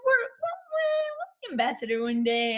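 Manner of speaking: lightly mocking, stretched-out high we're; imitating her friend. Cohost teasing her friend's vague old promise, lightly mocking. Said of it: "I've heard you say".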